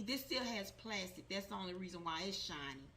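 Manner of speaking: ordinary delivery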